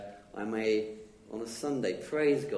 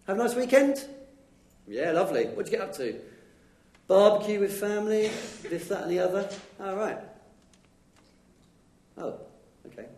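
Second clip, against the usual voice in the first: much higher, louder casual chit-chat; small talk chatter; 9 semitones higher, 6 dB louder, faster than usual. Retold or imitated. Churchgoer chatting about the weekend, bright and breezy. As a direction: breeze through a shallow chat. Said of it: "I don't tame my tongue in the sense".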